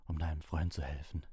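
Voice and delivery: rasping whisper; hoarse. Acted. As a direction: speak like a disused voice. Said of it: "sagte er heiser".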